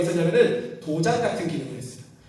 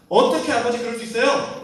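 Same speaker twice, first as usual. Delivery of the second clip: indignant protest; 6 semitones higher, 6 dB louder than usual. Acted. Elder son resents father's mercy.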